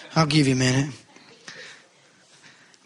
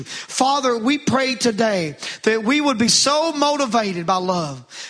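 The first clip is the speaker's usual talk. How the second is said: prayer; 5 semitones higher, slower than usual. Acted. Closing prayer.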